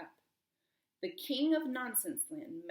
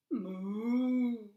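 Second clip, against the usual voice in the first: a long, louder moo as the pig; mock cow moo; 7 dB louder than usual. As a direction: draw out a silly moo.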